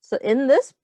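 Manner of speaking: ordinary talk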